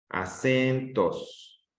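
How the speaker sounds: "acentos" slowly, with "cen" held long; stressed syllable drawn out. Shown as a demonstration. Teacher asking which syllable is strongest, "acentos" stretched on "cen". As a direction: stretch a-CEEEN-tos, falling on "tos". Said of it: "Dónde está la sílaba de mayor intensidad".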